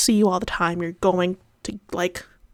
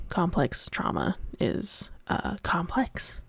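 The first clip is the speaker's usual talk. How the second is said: quiet; 2 semitones lower, 9 dB quieter, slower than usual. Real, not acted.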